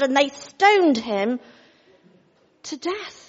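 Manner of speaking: ordinary delivery, nothing marked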